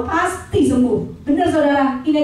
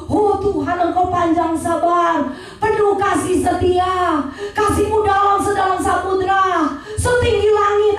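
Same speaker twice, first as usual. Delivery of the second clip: mock flowery prayer; 3 semitones higher, slower than usual. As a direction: parody a pompous prayer, drawn out and lofty. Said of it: "teman saya kalau berdoa begini loh". (doa berbunga-bunga yang dilebih-lebihkan, lambat dan mendayu)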